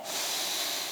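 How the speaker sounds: long breathy sigh of pleasure; soft sigh of delight; contented sigh. Acted. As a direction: breathe out slowly, savoring the air. Savoring spring air on drive.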